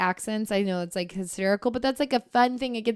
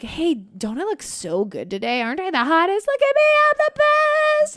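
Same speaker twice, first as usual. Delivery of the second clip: playful bragging; 8 semitones higher, 5 dB louder than usual. Acted. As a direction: climb into high, loud mock boasting. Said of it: "do it in a playful way".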